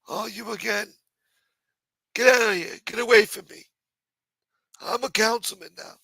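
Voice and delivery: high, dismissive put-on councilman voice; A-Light voice. Councilman brushing off the harasser, high put-on brushoff. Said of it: "My A-Light voice changed".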